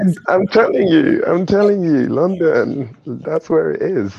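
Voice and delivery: high, loud, emphatic enthusiasm; excited insistence. Real, not acted. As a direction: pitch it up and insist with real excitement. Enthusiastically championing London to the host.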